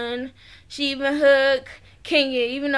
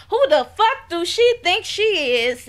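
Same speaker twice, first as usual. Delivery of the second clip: mock-indignant, slightly louder swearing; retold angry cussing; 4 semitones higher, 3 dB louder than usual. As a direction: imitate her cussing someone out. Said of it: "she cussing".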